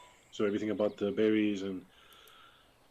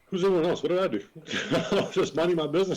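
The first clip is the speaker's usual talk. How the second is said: indignant protest; 6 semitones higher, 5 dB louder, faster than usual. Acted. Indignant in-character protest, laughing.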